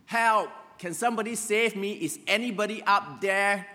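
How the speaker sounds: high, loud cry for rescue; desperate shouting for help. Retold or imitated. Man hanging from a cliff shouts up for rescue, desperate shouting. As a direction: yell up a cliff for someone to save you. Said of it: "he shouted to the top of the cliff".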